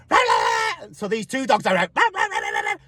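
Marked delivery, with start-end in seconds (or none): 0.1-0.9 shouting barks back at a yapping dog; shouted barking. Retold or imitated. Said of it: "go up to that fence and go". 2.0-2.8 quick high yaps; two dogs barking at each other; playful imitation of dogs barking at each other; mock dog barking. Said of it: "barking the shit at each other".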